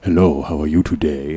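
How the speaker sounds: put-on low, smooth voice with a faint accent; Richard's low voice. Coworker charming a customer in a low smooth voice. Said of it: "his voice is really low".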